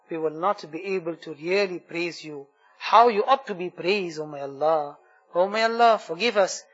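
fervent supplication, rising on each plea; heartfelt prayer. Acted. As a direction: pour out the dua to Allah, pleading. Leads an emotional dua.

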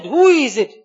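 a king's shout within a sermon; shouted question. Acted. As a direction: call out loudly to someone on the roof. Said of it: "and he shouted".

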